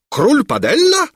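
very high, loud incredulous question; astonished exclamation. A narrator's reading. King echoing the minister's words, astonished. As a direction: shoot the name back in disbelief. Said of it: "wykrzyknął król".

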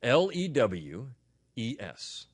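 slow spelling in two groups. Shown as a demonstration. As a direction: spell slowly, pausing before the last letters. Repeats spelling for listeners.

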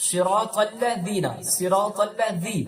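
slow, যী in সিরাতাল্লাযীনা with tongue tip at teeth; soft za. Shown as a demonstration. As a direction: make যী a soft z, not জি. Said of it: "জি পড়বেন না". (যী, জিনা নয়)

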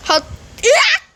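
high startled yelp; startled scream. Real, not acted. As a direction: jump at a sudden danger. Startled in game.

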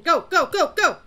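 urgent punched call to move; urgent shouting. Real, not acted. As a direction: shout for them to run. Urging teammates to run.

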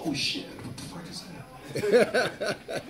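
quiet, unvoiced aside with a laugh in it; hushed disbelief. Comic reacting to a weird face online, hushed disbelief. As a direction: react under your breath, half laughing.

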